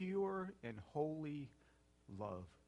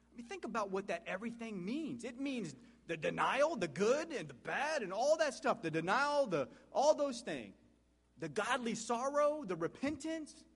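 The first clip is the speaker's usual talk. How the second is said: higher and louder, pressing each phrase home; fervent preaching; 8 semitones higher, 4 dB louder than usual. Acted. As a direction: preach it with rising fire. Preacher rises to full force.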